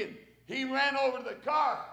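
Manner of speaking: ordinary talk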